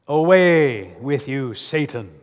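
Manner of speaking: slow, loud rebuke; shouting. Retold or imitated. Jesus rebukes the devil with a loud shout. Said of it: "Jesus shouts".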